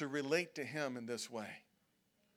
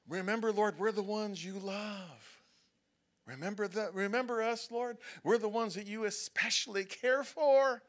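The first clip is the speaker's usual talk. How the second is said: higher pleading, louder at the end; mock pleading; 5 semitones higher, 4 dB louder than usual. Close believers mock-pleading with the Lord, higher. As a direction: coax the Lord like a favored child.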